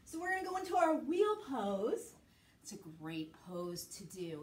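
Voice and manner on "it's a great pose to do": soft, low near-whisper; hushed aside. Real, not acted. Teacher talking children through wheel pose, hushed and low.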